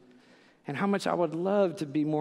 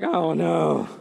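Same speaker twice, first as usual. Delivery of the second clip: mock dismay; 7 dB louder than usual. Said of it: "you're like".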